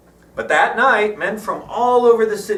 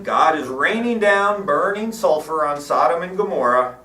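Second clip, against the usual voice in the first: usual speaking voice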